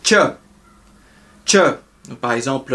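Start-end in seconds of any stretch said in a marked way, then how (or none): 0.0-1.6 "tche" clipped and breathy; "tche" bref et soufflé; unvoiced ch sound. Shown as a demonstration. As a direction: spit out a short "tche". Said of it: "C H qui se lit".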